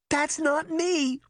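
high, drawn-out indignant cry. A narrator's reading. Girl denies to her sister she made the smell, crying out. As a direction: protest in a high, stretched-out voice. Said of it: "she cried".

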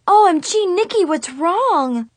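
high, loud alarmed cry; shocked shriek. A narrator's reading. Friends seeing Nikki in tears, shrieking in shock. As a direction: shriek in sudden worry. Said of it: "they both shrieked".

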